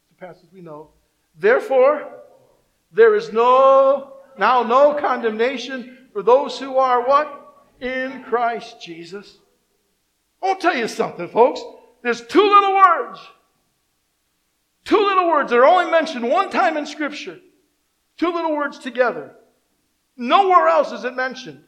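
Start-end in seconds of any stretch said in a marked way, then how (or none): 1.3-9.4 loud, slow, drawn-out scripture declaration; enthusiastic recitation. Shown as a demonstration. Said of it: "let's say it with enthusiasm". 12.0-21.6 raised, louder preacher's proclamation; emphatic preaching. Real, not acted. Preaches at full force.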